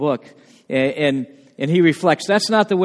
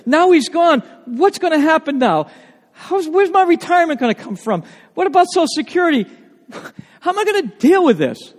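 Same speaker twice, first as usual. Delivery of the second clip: anxious sisters; 9 semitones higher than usual. Retold or imitated. Sisters' worried questions voiced.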